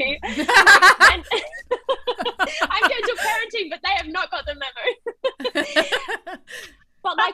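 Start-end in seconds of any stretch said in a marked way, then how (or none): 0.3-6.4 laughing through the joke about gentle parenting. Real, not acted. Mom joking to podcast friends, laughing. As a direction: giggle it out, amused at yourself.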